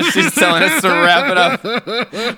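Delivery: laughing through the words, high and loud. Real, not acted. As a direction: giggle while talking. Laughing at hand signals.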